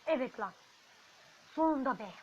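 real joy; triumphant shout. Real, not acted. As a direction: cheer it out at last. Finally gets another giant.

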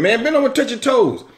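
high, emphatic order; forceful command. Retold or imitated. Preacher retelling the minister commanding the man to bend, forceful. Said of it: "when he said it that way".

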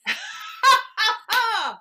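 loud, high laugh; big high-pitched laugh; burst of laughter. Real, not acted. Laughs at the interjection.

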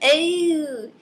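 high, very slow snooty British correction; posh British accent. Pretend. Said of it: "that's how I imagine her saying".

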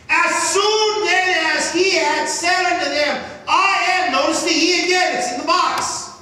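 loud, high, ringing sermon voice; preaching at full force. Acted. Preacher lifting his voice to the church, loud and high.

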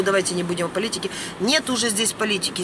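ordinary delivery

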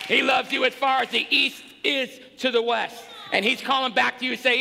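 loud proclamation of love; preaching shout. Real, not acted. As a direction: proclaim it to the back row.

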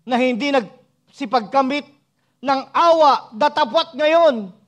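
loud, high, drawn-out scripture proclamation; full-force preaching. Real, not acted. Preacher proclaiming mercy from the verse, slow full-force preaching. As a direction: stretch the words and ring them out.

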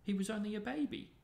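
high, quick tearful wail; sobbing. A narrator's reading. Lavender mourns her baby rabbit, acted sobbing. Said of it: "sobbed Lavender".

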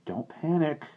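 high, nervous reassurance; trembling warning. A narrator's reading. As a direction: try to reassure while scared yourself. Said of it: "but his voice trembled".

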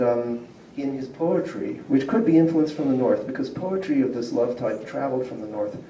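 ordinary talk, nothing marked

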